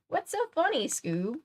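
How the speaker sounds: high-pitched questioning cartoon sidekick, dropping at the end; Shaggy. A narrator's reading. Voices Shaggy in the story.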